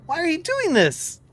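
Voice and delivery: high, tearful begging; crying plea. Acted. Wounded Rivers crying to Hidak, high tearful plea. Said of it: "like, crying".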